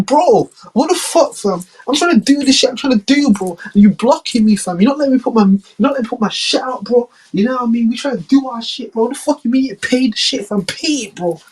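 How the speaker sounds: high, ungrateful demanding rant; Trump with attitude. Acted. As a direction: tell them off with attitude. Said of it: "called China with an attitude".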